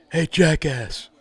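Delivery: slow, high, drawn-out taunt with a laugh in it; wrestler taunt. Pretend. Pretend wrestler heckling the cops, slow and laughing. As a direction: stretch every word like a wrestling heel.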